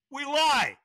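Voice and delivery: loud, high-pitched punchline answer; emphatic punchline. Real, not acted. Answering his own question to the audience, emphatic.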